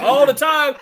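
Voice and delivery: high, loud parody through a laugh; mock church response. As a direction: chime in like an over-keen churchgoer. Mimics churchgoers' stock response, laughing.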